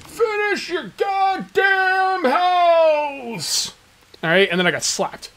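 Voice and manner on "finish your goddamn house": high, very slow drawn-out shout; mock yell. Viewers yelling at Cage to finish his house, high drawn-out shout. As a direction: bellow it like an angry scold. Viewers scolding him in retold scene.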